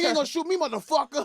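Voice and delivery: high, defiant dare with a laugh. Retold or imitated. Fee daring the officer, defiant high voice. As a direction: dare the cop to shoot.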